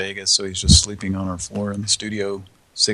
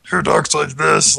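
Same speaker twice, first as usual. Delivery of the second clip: higher, louder, slow Muppet-ish squeak; 6 semitones higher, 4 dB louder than usual. Retold or imitated. Host mocking the guest who talks like a Muppet. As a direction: talk like a puppet character. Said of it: "Like a Muppet".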